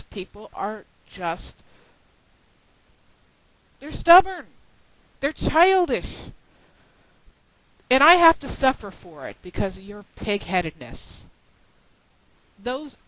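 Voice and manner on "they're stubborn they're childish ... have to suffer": angry outburst. Real, not acted. Venting at stubborn community members.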